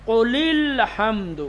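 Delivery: قل الحمد run together as "qulil-hamd"; hamza dropped. Shown as a demonstration. Teacher showing students how to read قل الحمد, linked as "qulil-hamd". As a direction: link قل straight into الحمد with no "a" sound. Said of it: "پڑھیں گے کیسے".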